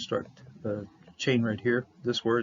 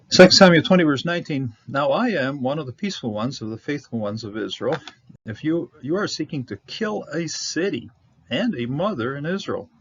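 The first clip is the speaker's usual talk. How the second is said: higher, projected verse reading; scripture reading; 3 semitones higher, 7 dB louder than usual. Acted. Teacher reads next Bible verse to students, raised reading voice. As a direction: read the verse out firmly, starting loud.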